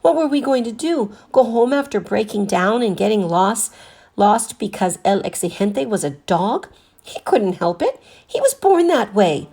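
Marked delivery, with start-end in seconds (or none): none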